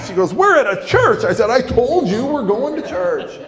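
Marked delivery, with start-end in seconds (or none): 0.3-1.2 high, loud mock girlfriend protest; pitched way up, indignant; outraged disbelief. Girlfriend outraged in car park. 1.5-3.4 high, raised-voice comeback; pitched way up, indignant; exasperated retort. Retold or imitated. Arguing back at girlfriend.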